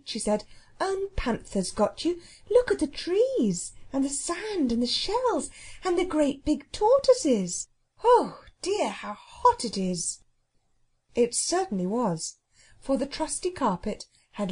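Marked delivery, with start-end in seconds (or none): 0.8-10.2 excited exclamation. Acted. Girl showing the startled cook the island, excited. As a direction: point out the sights in a high, eager voice.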